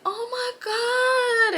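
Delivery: imitated tearful moan; mock wailing. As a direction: wail it high like a drunk friend overcome. Homegirl's tearful reaction in backseat.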